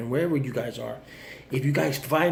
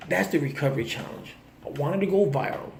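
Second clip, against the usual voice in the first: ordinary delivery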